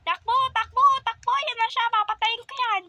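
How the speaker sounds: high frantic yell; panicked shout. Acted. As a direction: scream at him to run. Panicked fleeing.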